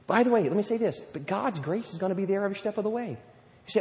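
ordinary talk, nothing marked

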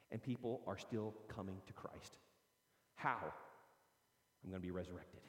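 preacher whispering the key point to the congregation; hushed awe. Real, not acted. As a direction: drop to a whisper to draw them in.

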